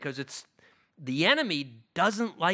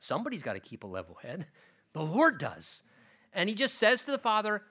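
ordinary talk

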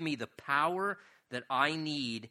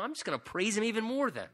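raised, insistent, stubborn resolve; defiant; 8 semitones higher, 5 dB louder than usual. Acted. Preacher voicing a believer who praises despite discouragement, defiant. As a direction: push it out high with mild defiance. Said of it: "It's almost like this defiant".

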